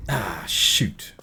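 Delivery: ordinary talk, nothing marked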